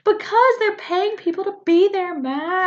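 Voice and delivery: high-pitched, insistent retort; playfully worked-up comeback; exasperated bickering. Real, not acted. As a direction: argue back high in comic exasperation.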